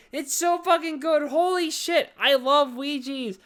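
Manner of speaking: high, loud burst of love; thrilled, emphatic raving; excited gushing. Real, not acted. Drunken gushing over favorite movie.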